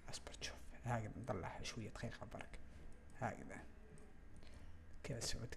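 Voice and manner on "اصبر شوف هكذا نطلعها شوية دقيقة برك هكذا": hushed self-reminder while working; whispered aside. Real, not acted. Tutor tells himself to wait while adjusting, whispering. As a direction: murmur it under your breath.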